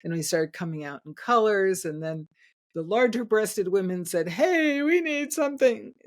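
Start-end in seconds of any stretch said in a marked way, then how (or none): 4.3-5.9 mock calling out. Women calling out to the bra makers, mock calling out. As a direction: call out in a raised, imitated voice.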